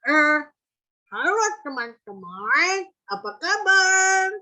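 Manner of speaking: slow, loud, strained cartoon hello rising high. Shown as a demonstration. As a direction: lift the tongue and greet slowly. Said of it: "terus misalnya ke atas". (sapaan lambat dengan lidah diangkat ke atas)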